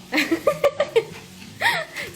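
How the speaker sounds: high-pitched giggling laugh. Real, not acted. Partner cracking up at the cook's accent, high laugh.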